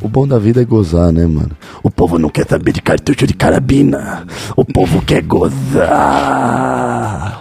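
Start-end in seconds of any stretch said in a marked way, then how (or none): 1.8-7.4 put-on politician's rally voice, building to a long-held last word; Lula. Joker quoting the president's speech, mock oratory. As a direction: orate it, then stretch the final word out. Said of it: "O Lula falou isso hoje".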